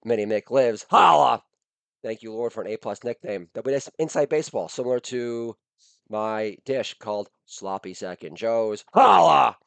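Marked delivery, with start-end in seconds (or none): 0.9-1.4 loud high holler; catchphrase shout. Acted. Host opening the podcast with a loud holler. 8.9-9.6 loud high holler; catchphrase shout. Acted. Host opening the podcast with a loud holler.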